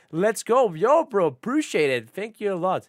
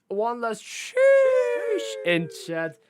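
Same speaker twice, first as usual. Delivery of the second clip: drawn-out hype; 8 semitones higher, 5 dB louder, much slower than usual. Acted.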